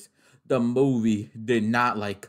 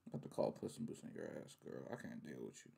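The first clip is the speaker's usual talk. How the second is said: much lower, much softer mock threat; under-breath threat; 12 semitones lower, 23 dB quieter, much faster than usual. Real, not acted. Fan grumbling at Clarice on screen, low soft threat. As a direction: grumble it to yourself.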